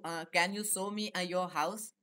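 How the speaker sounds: ordinary talk, nothing marked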